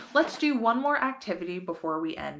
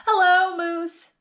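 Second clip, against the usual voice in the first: high, loud bright greeting; happy hello; 10 semitones higher, 7 dB louder than usual. A narrator's reading. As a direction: greet the dog with joy. Girl greets her dog.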